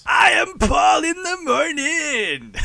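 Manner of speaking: high, slow hammy DJ announce; Morning Zoo voice. Acted. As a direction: ham it up like morning radio. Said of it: "Morning Zoo voice".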